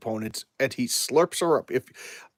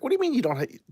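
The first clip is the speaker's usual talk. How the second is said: shocked disbelief; 12 semitones higher, much faster than usual. Retold or imitated. A woman voiced aghast that he has no friends, mock-stunned. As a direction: pitch leaps up in mock disbelief. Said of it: "she's like".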